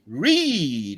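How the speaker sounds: one long, falling, stretched READ; grandfather's drawn-out word. Retold or imitated. Grandfather urging his grandson to learn, drawn-out exhortation. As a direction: draw READ right out like an old-timer. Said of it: "That's what he talked".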